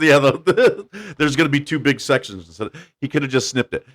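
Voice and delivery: usual speaking voice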